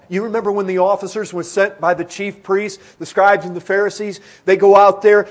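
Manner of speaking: loud, driving sermon storytelling; forceful preaching. Real, not acted. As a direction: preach the story at full volume.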